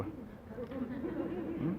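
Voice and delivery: very high, quiet questioning hum; knowing high hum. Real, not acted. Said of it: "Y'all do that?".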